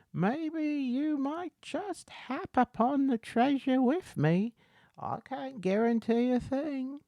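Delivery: very high, put-on character voice; hint slyly in a high-pitched voice; cagey beggar. Beggar offering to lead the way to treasure, cagey beggar.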